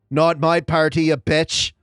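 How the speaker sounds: fierce Irish mother defending her party; Irish accent. Acted. Cleric to dragon in Irish accent, fierce. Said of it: "I say in my Irish accent for the character".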